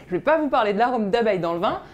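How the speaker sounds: normal talking voice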